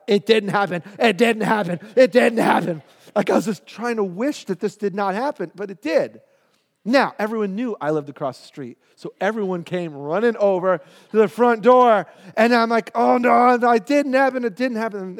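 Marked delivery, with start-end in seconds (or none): 0.1-2.8 louder, insistent repeated denial. Retold or imitated. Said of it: "and said out loud". 12.9-15.2 higher, louder panicky denial; mock panic. Boy panics as crowd arrives.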